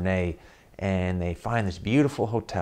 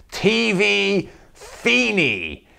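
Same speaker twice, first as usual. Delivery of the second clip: very slow, high, drawn-out "T V Feeney" with a falling end; stern demand; 11 semitones higher, 4 dB louder, much slower than usual. Retold or imitated. Angry father ordering French stranger to stop the TV, slow drawn-out. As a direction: lay out each syllable slowly like a command to a foreigner.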